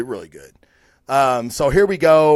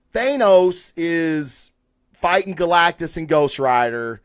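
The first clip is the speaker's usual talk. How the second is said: ordinary talk, nothing marked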